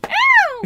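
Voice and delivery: mock yelp. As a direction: squeal a fake ouch while laughing. Fake pain yelp, laughing.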